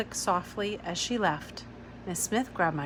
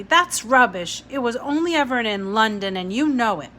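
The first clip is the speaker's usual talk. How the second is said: fierce, louder retort; 3 semitones higher, 8 dB louder than usual. A narrator's reading. Ada fiercely contradicting Miss Smith, angry. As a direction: snap it back hard. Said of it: "I said fiercely".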